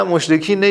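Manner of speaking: "مشرکین نه" ending in -ne; kasra ending. Shown as a demonstration. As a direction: say "مشرکین نه" as moshrekin-e. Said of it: "مشرکی نه که اصلاً نداریم". («مشرکین نه» با کسرهٔ آخر، مُشرِکینِ)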